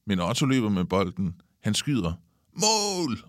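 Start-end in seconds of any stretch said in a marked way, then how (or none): 2.5-3.2 high, excited cry; goal shout. A narrator's reading. Otto has just scored at football, high goal shout. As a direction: cheer it out, pitched way up. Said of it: "råber Otto".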